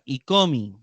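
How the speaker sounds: "icomi" run together as one word, no break. Shown as a demonstration. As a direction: glide "icomi" straight through as a single word. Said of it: "icomi se pronuncia como una sola palabra".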